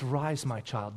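put-on low, hushed call to a child; quiet, tender bidding; gentle command. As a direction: say it very softly and reverently. Retells the real raising quietly.

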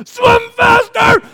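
very high, slow, strained yell; frantic cheering. Retold or imitated. Father cheering his son in the race, frantic high shout. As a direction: yell them on, stretching both words. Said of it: "I'm, like, cheering for Cullen".

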